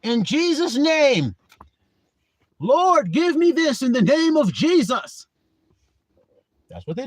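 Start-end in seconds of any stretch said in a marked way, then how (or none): 0.0-5.2 high, loud, slow begging prayer voice; mock pleading prayer. Preacher mocking how people beg in prayer, high and drawn out. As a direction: ham up a desperate prayer to the Lord. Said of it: "What do people say?".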